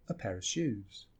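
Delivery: "a" in "a pair of shoes" as an unstressed uh; soft "a pair of shoes", the "a" reduced to schwa; reduced weak "a". Shown as a demonstration. Teacher repeating the schwa example, reduced. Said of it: "a whole word can become this sound".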